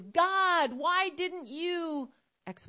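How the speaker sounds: high, drawn-out wail of protest; plaintive, stretched-out "why"; pleading to God. Acted. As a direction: cry out a complaint to heaven, slowly. Anguished plea to God, acted.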